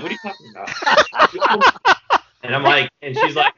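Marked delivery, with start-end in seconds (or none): 0.0-2.1 high, loud disbelieving cry; incredulous shriek. Retold or imitated. Shrieking at the cashier in disbelief.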